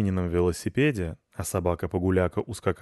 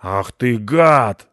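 loud, drawn-out cursing; angry shout; 3 semitones higher, 10 dB louder, much slower than usual. A narrator's reading. Husband spotting the cat in the garden, shouting. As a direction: roar it slowly at the culprit. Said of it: "закричал вышедший на шум муж".

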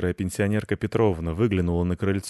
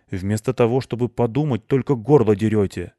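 louder, exasperated reproach; scolding; 3 semitones higher, 6 dB louder than usual. A narrator's reading. Hedgehog scolding two fighting cats, loud reproach. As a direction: tell them off, hitting it hard.

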